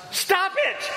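high, abrupt holler; exasperated high-pitched yell; retold yell. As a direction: bark it like the fed-up therapist. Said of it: "he just yells in her face".

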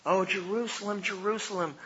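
soft, mournful appeal; understated grief, hushed and aching; weeping lament. Acted. Jesus lamenting over unwilling Jerusalem, softly mournful. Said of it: "He wept".

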